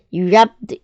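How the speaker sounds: ordinary delivery, nothing marked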